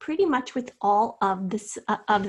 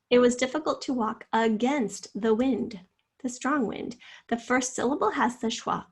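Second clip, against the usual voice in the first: usual speaking voice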